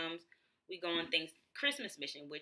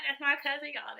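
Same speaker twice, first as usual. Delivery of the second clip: high, louder cheer for the crowd; proud bragging; 9 semitones higher, 6 dB louder than usual. Retold or imitated. Bragging to the crowd about her cousin, in a high, excited voice. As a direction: show her off, beaming. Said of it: "I was like".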